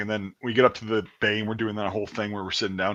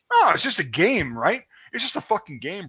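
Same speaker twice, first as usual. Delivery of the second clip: high, sing-song taunt; imitated sneering officer; mocking echo; 8 semitones higher than usual. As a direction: throw a recruit's words back sarcastically. Commander mockingly throwing his words back.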